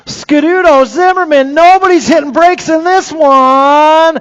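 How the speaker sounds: high, loud, urgent play-by-play; thrilled shout over the finish; excited race call. Real, not acted. Close battle, excited call.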